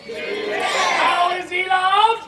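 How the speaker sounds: very slow, high, loud rising question; huge drawn-out question. Acted. Narrator builds to how the king is loved, drawn-out call. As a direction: stretch every word to a big climax. Same question repeated, bigger.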